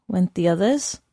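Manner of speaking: ordinary delivery, nothing marked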